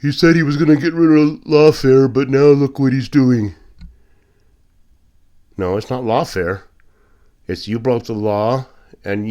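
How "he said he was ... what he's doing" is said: higher, louder whiny complaining voice; mocking critics. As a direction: sneer at the critics in a put-on voice. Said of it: "And if they say".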